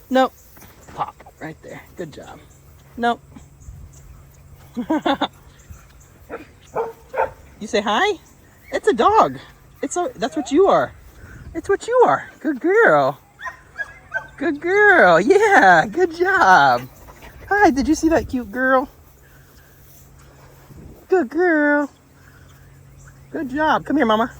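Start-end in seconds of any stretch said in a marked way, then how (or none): 4.8-5.3 quick high-pitched giggle; amused laugh. Real, not acted. Amused watching the dog. 7.6-16.9 high, sing-song praise to a dog; dog-talk praise. Real, not acted. Praising her dog during a greeting. 17.5-24.3 high, cooing greeting and praise; dog-talk. Real, not acted.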